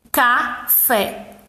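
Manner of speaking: CAFÉ broken into ca and fé; split into syllables. Shown as a demonstration. Teacher repeating for pupils, café in two separate beats. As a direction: pause in the middle of café. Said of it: "Duas sílabas".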